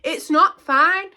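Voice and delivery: high, loud mock of the woman insisting it's not fine; hammed-up angry woman, high pitched; mocking the other driver. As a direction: imitate her indignant shrill protest. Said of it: "she's like".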